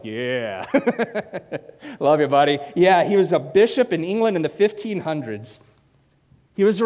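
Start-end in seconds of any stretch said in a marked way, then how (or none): 0.0-2.6 a hint of a buddy's low mumble; imitating Derek Buckley. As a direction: lightly mimic a friend's lazy grunt. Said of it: "I see Derek Buckley like".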